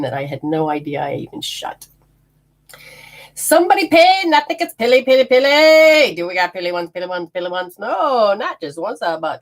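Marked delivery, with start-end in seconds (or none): 3.3-6.1 high, loud excited calling of a name. Real, not acted. Psychic thanking a viewer on a live stream, excited and high. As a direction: sing out the name to thank the payer.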